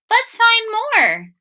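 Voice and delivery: put-on high and slow, eager child; little girl voice. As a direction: sing it out like a keen little girl. Lola's eager line.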